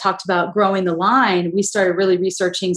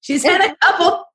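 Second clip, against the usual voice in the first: teasing announcer; 10 semitones higher than usual. Retold or imitated. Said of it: "and be like".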